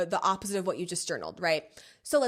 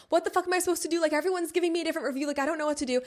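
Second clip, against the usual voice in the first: overwhelmed rant; 6 semitones higher, 3 dB louder, much faster than usual. Retold or imitated. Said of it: "You're like".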